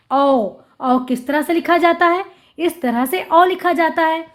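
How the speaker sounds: ordinary talk, nothing marked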